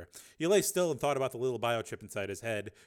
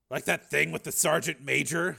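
wry jab, higher and louder; 5 semitones higher, 8 dB louder than usual. Acted. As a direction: toss it off with dry irony. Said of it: "Steele said wryly".

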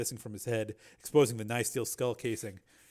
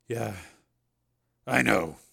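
dull; 4 dB louder, much slower than usual. A narrator's reading. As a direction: answer dully, drained of feeling. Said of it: "he said dully".

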